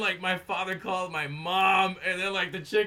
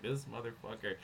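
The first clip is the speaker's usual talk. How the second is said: low, soft private cursing; inward grumble; 5 semitones lower, 10 dB quieter than usual. Retold or imitated. Son silently cursing his father in his head, low grumble. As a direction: say it as a thought, dropped right down. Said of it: "And in my head".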